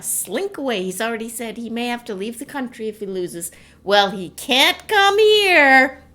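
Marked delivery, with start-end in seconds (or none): 3.8-6.0 emphatic joke. Acted. Joking Trump can't come here.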